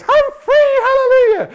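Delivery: high, squealing cry of freedom; freed girl. Retold or imitated. Slave girl freed from the demon, high and squealing. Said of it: "And she goes".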